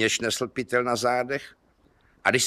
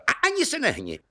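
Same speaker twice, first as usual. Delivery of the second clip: worked-up high warning; high, sudden command; high-pitched threat; 12 semitones higher, faster than usual. Acted. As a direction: order a felled thief about in a high, excited voice. Farmer threatens the felled thief.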